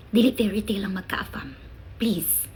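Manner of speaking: a whispered warning, no voice. Real, not acted. Vlogger whispers a warning to Filipina viewers. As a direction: hush it like a secret truth.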